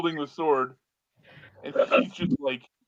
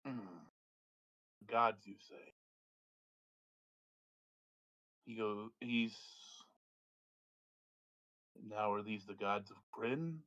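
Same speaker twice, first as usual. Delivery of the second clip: quiet wizard; 4 semitones lower, 10 dB quieter, slower than usual. Acted. Wizard questions the gods.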